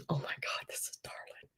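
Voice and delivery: gushing whisper. Real, not acted. Gushing over baby photo.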